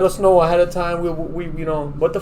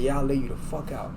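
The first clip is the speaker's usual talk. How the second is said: quiet, lower-pitched mock threat; low volume; 4 semitones lower, 8 dB quieter than usual. Drunk passenger threatens driver from back seat, low volume. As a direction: imitate a drunk muttering threats under his breath. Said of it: "in a low volume".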